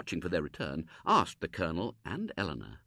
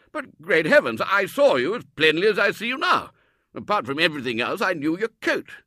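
very loud, high exclamation; blustering disbelief; astonished Colonel; 10 semitones higher, 10 dB louder than usual. Acted. Colonel insisting he saw Robert, loud and astonished.

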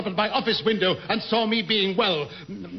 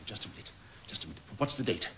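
muttering to himself; 13 semitones lower, 13 dB quieter, much faster than usual. Acted. Captain realising, muttering to himself.

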